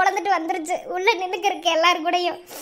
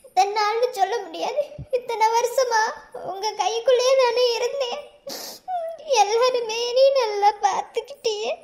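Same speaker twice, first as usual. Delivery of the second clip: halting, sobbing bride-to-be; tearful; 3 semitones higher than usual. Acted. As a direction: let sobs break up the phrases. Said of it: "நல்ல விஷயம் நடக்கும் போது இப்படி அழுகலாமா?". (விம்மி விம்மி அழுதபடி பேசுதல்)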